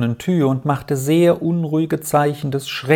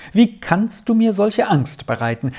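much higher, distraught plea; agitated cry; 8 semitones higher than usual. A narrator's reading. Aunt horrified at her brother's words, pleading in alarm. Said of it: "rief die erregte Tante einmal ums andere aus".

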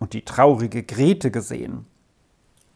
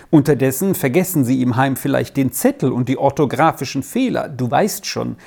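ordinary delivery, nothing marked

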